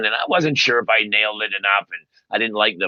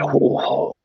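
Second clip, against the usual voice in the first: stunned oh. Retold or imitated. Shocked reaction to cancer news.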